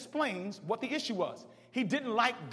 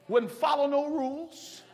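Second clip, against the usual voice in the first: usual speaking voice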